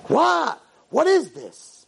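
high-pitched appalled disbelief; aghast outburst; shocked. Acted. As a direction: recoil and demand an explanation. Acted shocked first look at tattoos.

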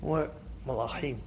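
very soft, low voice; hushed. Shown as a demonstration. Teacher contrasting angels with screamers, hushed. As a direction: drop right down to a gentle hush.

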